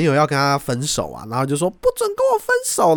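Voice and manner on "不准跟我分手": put-on high, whiny boyfriend voice, much higher; mock the clingy boyfriend pleading; imitating the boyfriend. 男友拒绝分手. (模仿男友尖声哀求)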